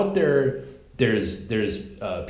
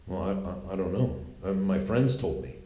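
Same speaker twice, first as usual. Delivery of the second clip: much softer, lower, trailing mumble; sheepish protester; 6 semitones lower, 6 dB quieter than usual. Retold or imitated. Protester caught out by the interviewer, mumbling sheepishly. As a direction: shrink away, clueless and caught out. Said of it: "It's like".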